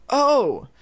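startled gasp. A narrator's reading. Spots the missing dummy.

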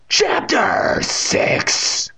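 very slow, high shouted heading; theatrical announcement. A narrator's reading. Narrator announces chapter after cliffhanger, theatrical shout. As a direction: shout it out, stretching each word.

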